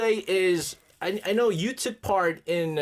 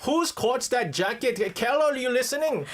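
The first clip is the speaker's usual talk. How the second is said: higher, lilting Welsh accent; 6 semitones higher than usual. Shown as a demonstration. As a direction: copy the Welsh accent in a raised singsong. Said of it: "you can do the Welsh accent".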